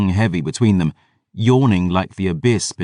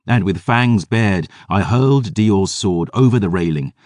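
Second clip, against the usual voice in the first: ordinary delivery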